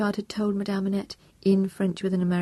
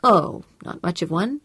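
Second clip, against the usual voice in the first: modest; 5 dB louder than usual. A narrator's reading. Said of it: "said Tom modestly".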